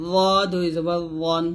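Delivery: র and ওয়ান as a thick, rounded voiced th; full-mouthed dh, rounded to o, in র; heavy zho. Shown as a demonstration. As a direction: make ওয়ান heavy, tongue at upper teeth. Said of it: "মোটা করে".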